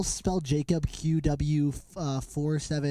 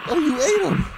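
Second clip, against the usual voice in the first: higher, louder shocked repeat; 10 semitones higher, 6 dB louder than usual. Real, not acted. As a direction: cry it out in disbelief.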